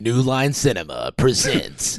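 slow epic studio intro; movie trailer announcer. Pretend. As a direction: present it like a big premiere. Joining the trailer bit.

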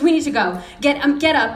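mock-alarmed wife urging him up; retold scream. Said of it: "And she screamed".

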